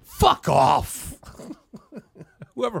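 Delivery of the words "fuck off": low, loud TV-drama outburst; hammed-up forceful brush-off; mock dismissal. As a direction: bellow it low like a drama patriarch. Imitating the show's catchphrase.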